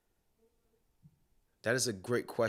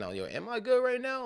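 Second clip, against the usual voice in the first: high, surprised inner voice; startled self-question; 14 semitones higher than usual. Retold or imitated. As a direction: catch yourself off guard. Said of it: "me actually thinking".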